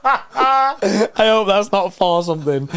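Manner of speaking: high, loud laughing through the words; slow, giggling disbelief. Real, not acted. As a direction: tease a friend while cracking up. Laughing at partner's gaffe.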